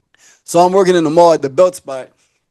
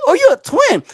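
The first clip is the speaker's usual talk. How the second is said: mock surprised girl; 13 semitones higher than usual. Girl finding out he's a twin, imitated with high surprise. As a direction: imitate her high, astonished question.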